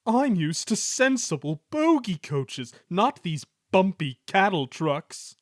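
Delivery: high, loud indignant complaint; haughty grumble; grumbling. A narrator's reading. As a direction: protest like a snob. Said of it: "he grumbled".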